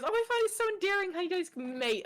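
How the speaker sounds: high-pitched gushing friend impression; Sweet. Friend charmed by British slang, imitated gushing. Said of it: "she sits and goes".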